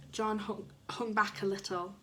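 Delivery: usual speaking voice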